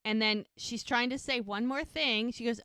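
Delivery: usual speaking voice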